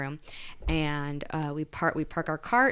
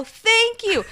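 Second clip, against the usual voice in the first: much higher and louder thank-you; gushing thanks; 6 dB louder than usual. Retold or imitated. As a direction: pour out the gratitude. Thanking manager after relief.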